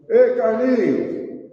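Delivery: loud, drawn-out hail; mock calling out. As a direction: holler a name across a crowd. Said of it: "Mas Deus não fala assim".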